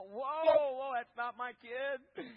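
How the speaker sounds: loud, high startled whoa, slowing down; shocked disowning cry. Retold or imitated. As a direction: recoil as if the kid isn't yours. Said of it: "you just go".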